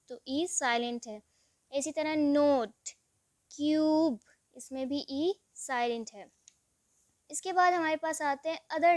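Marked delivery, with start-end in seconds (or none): none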